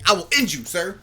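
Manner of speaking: mock threat. Acted threat in [name]'s comeback.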